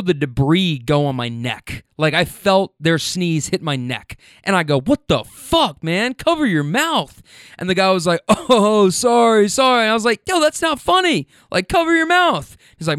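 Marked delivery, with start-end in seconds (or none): 4.8-7.2 higher, stretched-out indignant scolding; acted outrage. Scolding passenger who sneezed on him. 8.3-9.8 high, louder "oh, sorry"; imitated sheepish apology. Passenger apologises to angry driver. 10.3-12.6 very high-pitched exasperated telling-off; acted indignant scolding. Scolding the sneezing passenger, retold.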